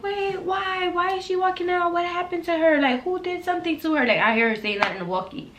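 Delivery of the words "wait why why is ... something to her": much higher, worried questions; concerned manager. Retold or imitated. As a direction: voice the manager's alarm on the walkie. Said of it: "manager three is like".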